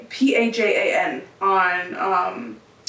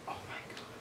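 breathless whispered disbelief; stunned whisper; 23 dB quieter than usual. Retold or imitated. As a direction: gasp it out in a hush. Stunned by the dead dog's sneeze.

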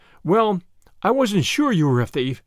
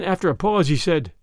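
usual speaking voice

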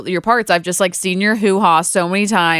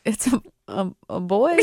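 hesitant, softer imitation of a confused dad; unsure mock dad; 7 dB quieter than usual. Imitating dads unsure at the birth, hesitant and soft. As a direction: stall quietly before guessing.

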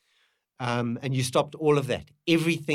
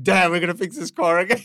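exasperated groan; 6 semitones higher, 7 dB louder, faster than usual. Retold or imitated. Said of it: "we're like".

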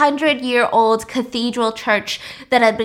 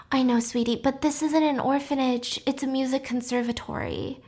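soft, sympathetic but firm; gentle refusal; 2 semitones higher, 6 dB quieter than usual. Retold or imitated. Paloma gently refuses her plea.

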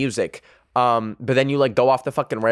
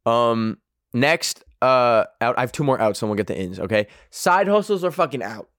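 ordinary talk, nothing marked

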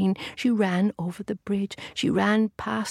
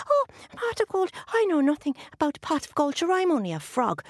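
leprechaun; 8 semitones higher than usual. A narrator's reading. Leprechaun character pleading.

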